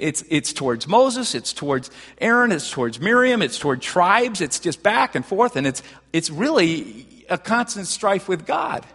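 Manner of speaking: ordinary talk, nothing marked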